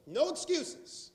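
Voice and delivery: slow, high and punched out; emphatic. Real, not acted. Speaker driving his point home to the room, slow and emphatic. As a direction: hammer each word home.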